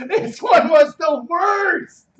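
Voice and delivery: slow, loud, high exasperated shout; drawn-out shout. Real, not acted. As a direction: stretch every word out in outrage. Groaning about a bad game.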